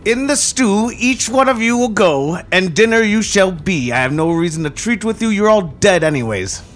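high, loud screeching hag voice; old hag. Acted. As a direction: shriek it like a witch in her lair. Hag answers from the dark.

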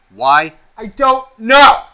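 loud, high yell, slowly; angry outburst. Real, not acted. Ranting host yells at listeners in frustration. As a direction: blow up at the listeners. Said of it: "Am I getting angry at you guys?".

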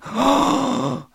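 long, high shocked gasp; sharp intake of breath as one; crowd gasp. A narrator's reading. Crowd hears a new suspect named, gasping. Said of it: "As one, the crowd went".

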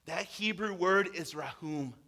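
ordinary delivery

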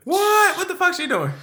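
high-pitched, startled questioning; incredulous surprise. Real, not acted. Friend reacting to news about [name], incredulous. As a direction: voice leaps up in disbelief.

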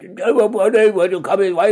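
loud, very high mock-Swedish wail; hammed-up, hopeless Swedish accent; bad Swedish accent. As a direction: belt out garbled fake Swedish in panic. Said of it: "somebody with a Swedish accent".

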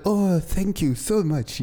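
put-on slow, high Arabic-accented thanks. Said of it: "You put on your Arabic accent?".

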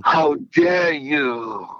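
drawn-out, high, indignant accusation; Greta Thunberg. Retold or imitated. Co-host quoting the climate activist's speech, high drawn-out mock outrage. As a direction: stretch it out like an outraged young activist. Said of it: "that's what she said. She said".

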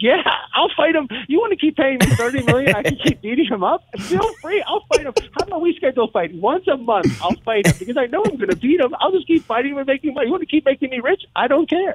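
a hint of a cocky Poirier shrugging off McGregor; cocky Poirier impression. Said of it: "Poirier's like".

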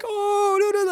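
very high imitation of foreign gushing; cooing foreigners. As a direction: mimic foreigners fussing over a cute dog. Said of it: "like, speaking foreign, like, language".

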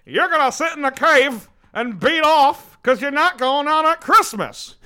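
loud, high, slow scolding; angry dad. Retold or imitated. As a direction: yell it like a bullying father. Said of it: "he's like".

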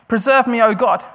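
pleading cry to God. Retold or imitated. Preacher voicing David crying out to God. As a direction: cry it out, lifted and urgent. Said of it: "David here is crying out".

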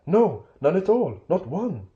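high, anxious hobbit backtracking; hasty denial. A narrator's reading. Bilbo covering for his friends, hasty and high. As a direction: take it back in a high panic. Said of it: "he said immediately afterwards".

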